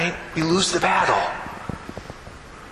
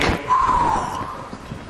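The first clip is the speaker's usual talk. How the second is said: calming sigh after the breathing drill; long slow exhale; 3 dB quieter than usual. Shown as a demonstration. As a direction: blow it all out slowly. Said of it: "breathing exercise".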